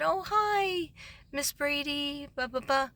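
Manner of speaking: high sing-song mock of the office staff; chirpy office greeting. Staff at the front office greeting the teacher, high and sing-song. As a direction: greet her in a bright, high put-on voice.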